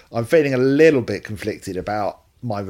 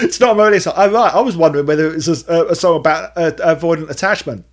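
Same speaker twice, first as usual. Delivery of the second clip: mock cohost; 7 semitones higher, 8 dB louder, faster than usual. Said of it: "mocking cohost's reply".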